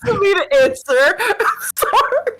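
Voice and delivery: loud, high laughing speech. Real, not acted. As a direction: burst out laughing mid-sentence. Cracking up during banter.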